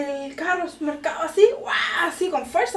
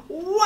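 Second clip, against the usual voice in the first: high drawn-out "waah" battle cry; charging yell breaking into laughter; mock charging roar; 6 semitones higher, 5 dB louder than usual. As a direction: hurl yourself in with a yell. Charging like a bull.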